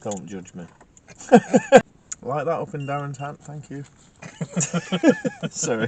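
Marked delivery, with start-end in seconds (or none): 1.1-1.8 sudden high, loud laugh bursting out; loud burst of laughter. Real, not acted. Ghost hunter laughing at Margaret's reply, loud high burst of laughter. Laughing at his own swearing. 4.2-5.4 high laugh. Real, not acted. Laughing as ghost lights up.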